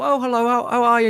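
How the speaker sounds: high, put-on polite telephone greeting; nan's phone voice. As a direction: mimic nan switching on her phone manners. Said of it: "your nan used to have the phone voice".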